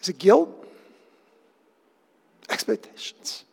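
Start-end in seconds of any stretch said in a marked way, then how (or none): 2.5-3.4 whispered word. Acted. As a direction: breathe the word out unvoiced. Listing motives in a pause.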